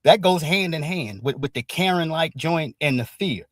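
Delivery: usual speaking voice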